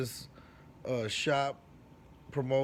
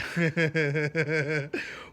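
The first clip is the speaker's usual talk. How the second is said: laughter; 4 semitones higher, 5 dB louder than usual. Real, not acted. Laughing while retelling his boast to classmates. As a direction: burst of real laughter.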